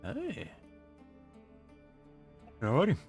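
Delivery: normal talking voice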